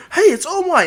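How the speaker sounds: high, loud excited call; high-pitched cry of recognition; excited fan. Acted. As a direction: shout it like a thrilled crowd spotting their hero. Crowd spots the hero.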